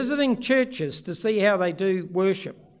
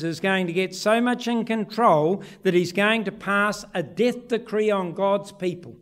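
usual speaking voice

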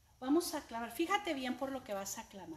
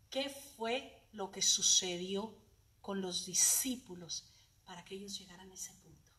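usual speaking voice

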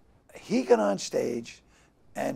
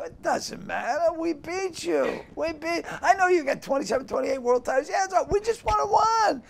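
heated fan retort; 12 semitones higher than usual. Retold or imitated. Red Sox fan answering a Yankee fan, heated. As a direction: crow it back at a rival fan, pitched way up.

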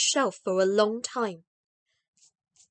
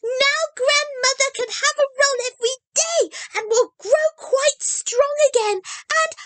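very high and louder, jubilant; a little girl jumping about in triumph; exultant; 4 dB louder than usual. A narrator's reading. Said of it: "in a tone of exultation".

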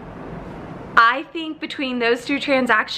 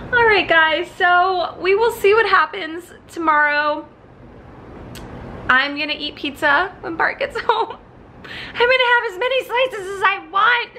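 higher, bubbly sign-off; excited; 9 semitones higher than usual. Real, not acted. Flight attendant cheering up her viewers over pizza, bubbly and high.

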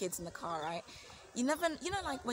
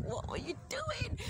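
very high, slow squeak of disbelief; drawn-out, high-pitched incredulity; mock self-reproach; 4 dB quieter than usual. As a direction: scold yourself in a thin high voice. Scolds herself over the crash.

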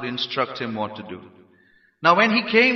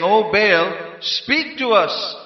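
loud, raised, drawn-out plea; calling on Baal; 5 semitones higher, 5 dB louder, slower than usual. Acted. Preacher acts the prophets calling on Baal, loud calling. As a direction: call out slowly to a god. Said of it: "They are calling on the name of Baal".

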